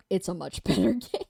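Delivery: laughs partway through the praise; laughing. Real, not acted. Streamer praising Demon Souls to a friend, laughing.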